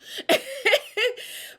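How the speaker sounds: a high, bright laugh at her own story; high burst of laughter. Real, not acted. Laughing at how her therapist grills her.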